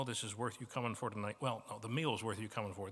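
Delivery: ordinary talk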